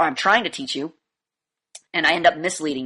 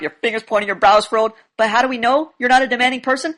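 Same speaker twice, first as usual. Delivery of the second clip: usual speaking voice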